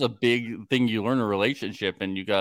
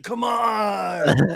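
hammed-up shout; 11 semitones higher than usual. Roxanne begging to bring her guns, hammed-up high shout. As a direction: belt it out like a brash cast member. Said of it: "She's like".

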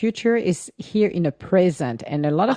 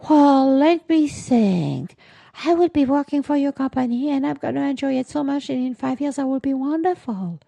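mock eager candidate; 8 semitones higher than usual. Answering the five-year question, mocks a candidate's eager reply. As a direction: ham up the eager interviewee. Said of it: "What are you gonna do in five years?".

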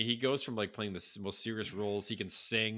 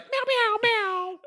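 cat meowing; 11 dB louder, much slower than usual. Retold or imitated. Quoting the Sex Panther cat noise.

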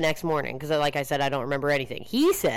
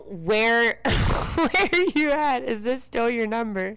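put-on drunk texting voice; 5 semitones higher, slower than usual. Reading her drunk text aloud.

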